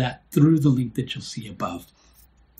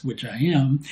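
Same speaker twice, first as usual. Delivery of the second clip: ordinary talk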